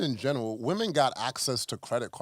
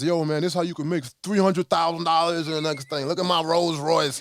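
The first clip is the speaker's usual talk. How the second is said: louder, higher get-rich pitchman parody; mock hype ad; 5 semitones higher, 6 dB louder than usual. Mocking flashy money ads online with louder, higher hype. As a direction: sell it like a flashy online guru.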